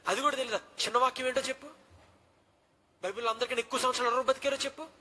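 put-on stern dean grilling the boy, loud and quick; rapid-fire questions. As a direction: rattle them off like a stern dean grilling a kid. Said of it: "అని టకా టకా నన్ను ప్రశ్న వేస్తే".

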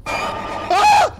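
shrill startled scream; real scared scream. Real, not acted. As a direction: shriek at the scare. Jump scare in horror game.

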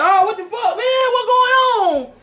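hammed-up panicked shout as the second man sees the point man hit; mock panicked soldier. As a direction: play a rattled soldier freaking out under fire.

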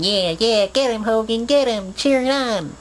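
put-on high excited kid cheering from the crowd; little kid cheering. A grown fan replaying his childhood self cheering Hulk Hogan, high excited yell. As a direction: shout it up high like a young fan at ringside. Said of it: "that was me, a little kid".

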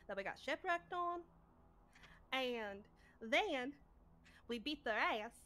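soft-spoken southern storyteller; southern accent. Acted. Knife dictating the captain's log about yesterday, southern accent. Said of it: "Can I say in the southern accent for that long?".

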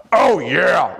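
loud high wrestler shout; Randy Savage excitement. Retold or imitated. Coach recapping the wrestler version, loud excited shout. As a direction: bellow it like a pumped-up wrestler. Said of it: "Randy Savage with excitement and enthusiasm".

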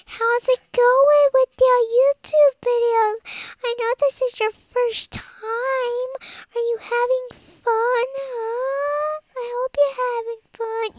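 high, slow, drawn-out parent voice; gushing parent. Retold or imitated. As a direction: coo it high and slow, mocking a doting parent. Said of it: "my dad and my mom to come in to the room and go".

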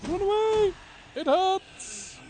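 loud, high panicked yelling; mock panic. Player fleeing incoming enemies, hammed-up panicked shout. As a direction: shriek like a fleeing coward.

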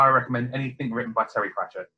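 usual speaking voice

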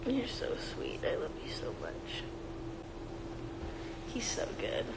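very soft, half-whispered affection; tender cooing. Real, not acted. Owner telling the cat it is loved, tender whisper. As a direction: murmur love to a pet.

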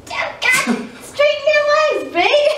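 high, loud laughing call; laughing shout. Real, not acted. Laughing while straining in pose.

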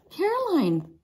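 loud high alarmed call; father bellowing a warning. A narrator's reading. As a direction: shout her name across the deck. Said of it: "Papa bellowed".

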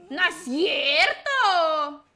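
loud, long-drawn cry of disbelief; disbelieving wail. Real, not acted. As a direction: wail it out, stretching the middle. Disbelief at a game mishap. (lamento a gritos, incrédulo)

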